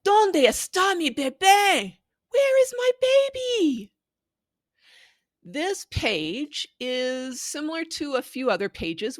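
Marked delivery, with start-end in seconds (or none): 0.0-3.9 put-on high, loud calling out for her baby; a worried mama searching for her little one; anxious mother calling.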